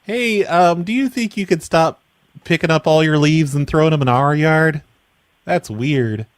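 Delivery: ordinary talk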